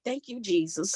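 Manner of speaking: retold soft sigh of thanks; hushed relief. Patient recalling the diagnosis from the psychiatrist, hushed relief. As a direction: drop to a soft, grateful aside.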